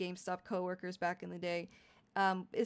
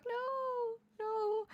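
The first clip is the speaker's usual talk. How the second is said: squealed no; 13 semitones higher than usual. Real, not acted. Squealing denial to chat.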